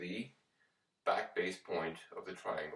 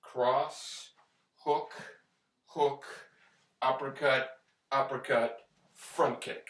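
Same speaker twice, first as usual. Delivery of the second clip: calling out each punch, slightly louder; boxing coach calling combos; 3 semitones higher, 3 dB louder, much slower than usual. Shown as a demonstration. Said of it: "the combination is gonna be this".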